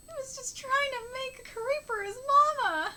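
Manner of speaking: high, upset wail of sympathy; real heartbroken high-pitched reaction; tearful lament. Real, not acted. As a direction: say it as tears rise. Tearful reaction to sad scene.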